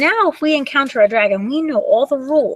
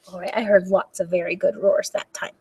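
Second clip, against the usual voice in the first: usual speaking voice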